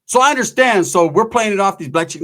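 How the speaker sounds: ordinary talk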